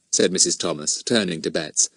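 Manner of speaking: ordinary delivery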